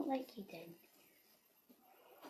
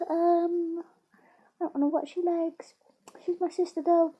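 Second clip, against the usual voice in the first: high, louder dog voice; dog's voice; 4 semitones higher, 7 dB louder, slower than usual. Pretend. Girl voicing her dog's silly confession, high and loud.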